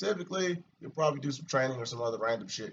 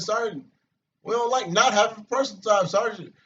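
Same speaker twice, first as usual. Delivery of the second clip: higher and louder, like sounding off in formation; retold sound-off; 8 semitones higher, 8 dB louder than usual. Soldier answering the sergeant in formation, loud and high. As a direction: call it out to the sergeant, bold. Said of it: "And I piped up and said".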